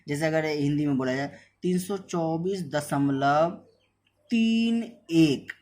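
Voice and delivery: "तीन एक" धीरे और ऊँचा, हर अंक अलग; दशमलव के बाद के अंक एक-एक करके खींचकर बोलो. Shown as a demonstration. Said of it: "ऐसे पढ़ते हैं।". ("तीन एक" को धीमे, अलग-अलग अंकों में बोलना)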